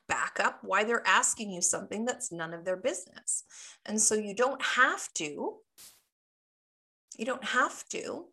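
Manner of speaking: ordinary talk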